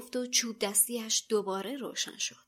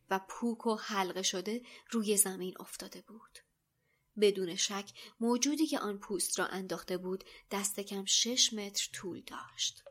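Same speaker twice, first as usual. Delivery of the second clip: ordinary delivery, nothing marked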